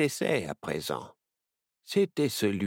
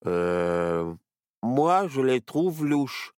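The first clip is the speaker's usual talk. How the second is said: stammering; 3 semitones higher, 3 dB louder, much slower than usual. A narrator's reading. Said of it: "bredouilla Barnabé".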